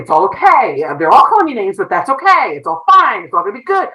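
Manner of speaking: insisting all is fine while breaking inside; putting on a brave face. Retold or imitated. Principal telling herself she is fine, strained and brave. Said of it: "as a principal, I've gotta".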